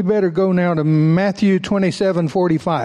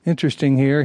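ordinary delivery

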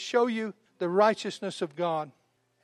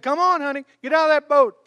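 higher, louder coaxing call; calling Peter out; 6 semitones higher, 6 dB louder than usual. Retold or imitated. Jesus calls Peter out of the boat. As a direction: invite him out with a grin.

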